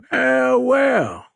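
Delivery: very high, loud Cajun captain; drawn-out, high-pitched Cajun greeting; thick Cajun accent. A narrator's reading. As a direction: crow it loudly in Cajun. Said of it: "in a thick Cajun accent".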